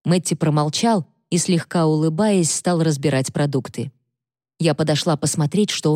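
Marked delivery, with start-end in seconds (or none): none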